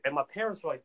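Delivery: usual speaking voice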